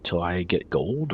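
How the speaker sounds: usual speaking voice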